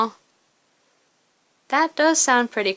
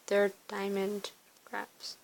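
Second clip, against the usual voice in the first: quiet mutter; 2 semitones lower, 13 dB quieter, slower than usual. Real, not acted. Dismayed at the in-game choice.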